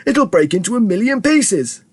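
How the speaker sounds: high, horrified cry; high-pitched panicky warning; alarmed shout. A narrator's reading. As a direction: wail it in alarm. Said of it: "shouted Grandpa Joe".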